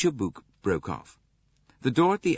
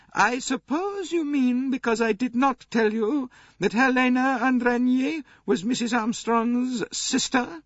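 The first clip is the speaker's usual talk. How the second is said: put-on very high, louder, contemptuous old princess; 5 dB louder than usual. A narrator's reading. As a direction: answer him with open contempt. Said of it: "Princess Dragomirov answered contemptuously".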